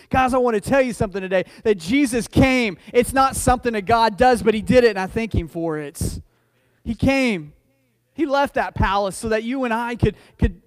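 loud, emphatic sermon delivery; forceful preaching. Real, not acted. Preacher telling the congregation Jesus came, loud and forceful. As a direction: drive it home to the congregation, loud.

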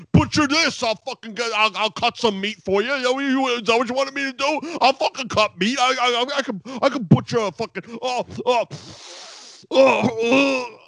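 hammed-up high raging sputter; Taz. Commentator told he is butchering English, imitated apoplectic high sputtering. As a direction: rant until words collapse into strained groans. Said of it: "going to have a fucking stroke".

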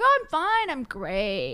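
high, loud, put-on breezy answer; autopilot reply. Someone answering on autopilot, mock-cheery. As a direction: toss out a mock-cheery stock response. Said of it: "on autopilot".

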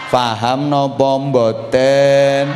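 very slow, stretched-out check on the audience; drawn-out question. Real, not acted. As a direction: stretch every word of the question. (pitakon alon, diulur-ulur)

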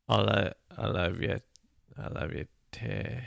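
Cockney sliding into Australian; hesitant, quiet stab at Cockney; unsure Cockney with Australian vowels; attempted Cockney accent. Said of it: "I'm trying to work my way out of Australian".

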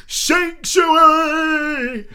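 high, long drawn-out cry of sanctuary; hammed-up, high-pitched proclamation; dramatic yell. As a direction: imitate a heroic yell, stretching every syllable. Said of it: "he holds up Esmeralda and he yells".

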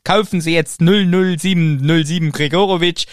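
loud, pushy TV-shop pitch; chipper 1950s ad. Retold or imitated. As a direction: sell it like a cheesy commercial. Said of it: "es wäre eine P-R-Aktion gewesen". (aufdringliche Werbestimme)

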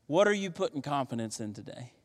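usual speaking voice

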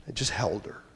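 soft whisper; whispered tender memory. Real, not acted. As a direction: hush it tenderly. Tender moment in story.

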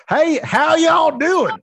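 high, slow hammed-up Southern twang; cowboy greeting. Joking about a cowboy hat at the open mic, a hammed-up Southern twang. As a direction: drawl it out like a cowboy in a big hat. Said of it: "I should still bring a cowboy hat".

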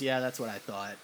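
low, soft deadpan dad; dry dad reply. Retold or imitated. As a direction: answer flatly like an unsurprised father.

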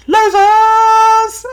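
very high, drawn-out taunting yell; jeering shout. Retold or imitated. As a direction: yell it out of a car window. Yelling out the car window.

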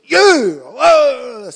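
high, loud imitation of a screaming cyclist; mock angry yell. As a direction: holler it out, high and drawn-out. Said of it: "came screaming along ... yelled at me".

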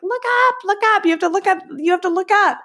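high-pitched excited urging, retold; insistent, high mock freak-out. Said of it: "just freaking out".

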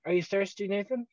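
mom's casual question. Retold or imitated. Mother's question voiced in story.

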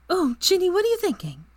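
bouncing with excitement; excited. A narrator's reading. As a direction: Hermione asks Ginny her plan, thrilled. Hermione excited.

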